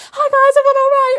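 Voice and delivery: high, loud breezy greeting; mock-casual shout of hello; chirpy offstage castmate. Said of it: "she's all like".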